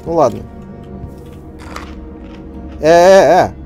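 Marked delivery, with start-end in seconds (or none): 2.8-3.5 loud protesting shout. Real, not acted. Reacting loudly to game.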